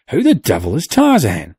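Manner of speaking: loud, high rough outcry; cry out. A narrator's reading. Sailor crying out about the sign. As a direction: demand it of the group. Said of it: "cried the sailor".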